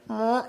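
put-on high little baby mewl; imitated baby noise. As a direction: make a small baby's pleading noise. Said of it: "one of the babies actually kinda went".

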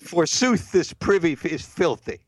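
high, theatrical Elizabethan voice; hammy stage actor. Retold or imitated. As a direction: ham it up like an old stage actor. Mock Elizabethan complaint.

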